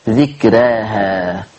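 ذكراها drawn out slowly and low; slow dhikraha. Shown as a demonstration. Teacher models the exception ذكراها slowly. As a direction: stretch ذكراها long. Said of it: "استثناها اللفظ ايه".

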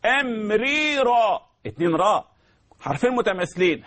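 usual speaking voice

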